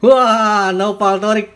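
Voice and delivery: loud, high burst of surprise; excited winner reveal. Real, not acted. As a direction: shout the winner's name, thrilled. Reading out the drawn winner.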